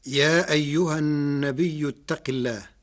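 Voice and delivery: measured, chanted tarteel of the verse; Quran recitation. Shown as a demonstration. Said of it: "قال الله تعالى في سورة الأحزاب".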